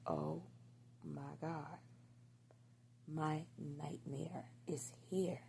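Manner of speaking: soft, slow near-whisper of dread; retold horror under the breath; hushed dread. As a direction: let it sink out quietly, as if the worst has arrived. Dreading partner's arrival in retold scene.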